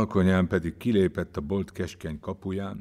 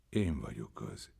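quiet; 8 semitones lower, 9 dB quieter than usual. A narrator's reading. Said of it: "csendesen kezével, és így szólt".

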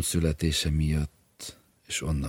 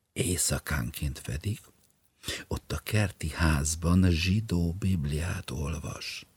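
slow, secretive whisper; wife whispering a secret scandal; confiding whisper; 2 semitones higher than usual. Acted.

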